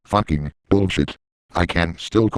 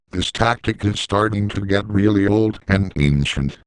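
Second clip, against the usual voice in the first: normal talking voice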